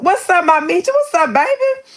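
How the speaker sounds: very high squealed hello; light up and call out a happy hello; excited greeting. Real, not acted. Streamer greeting her regular viewer Meechie, squealed excited hello.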